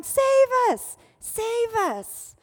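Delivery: loud, high imploring cry; shouting. Retold or imitated. Crowd crying out to their Messiah, shouting. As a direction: cry out like a crowd calling for rescue. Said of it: "So they're actually shouting".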